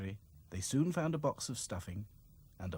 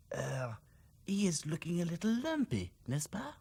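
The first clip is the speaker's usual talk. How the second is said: lightly French doll voice; a touch of French charm; French accent; 8 semitones higher than usual. A narrator's reading. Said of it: "said Claude".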